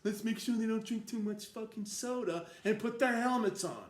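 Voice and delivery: loud, high sarcastic yes-man voice; loud mocking impression of a smug billionaire. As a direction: ham up a fawning rich guy agreeing. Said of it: "I would say that".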